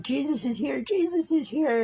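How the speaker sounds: excited. Retold or imitated. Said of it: "stuttering all over the place, saying".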